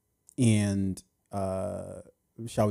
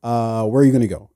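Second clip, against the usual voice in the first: ordinary delivery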